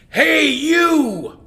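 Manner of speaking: loud high roar; roaring lion. Acted. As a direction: shout down into the well. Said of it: "roared the lion".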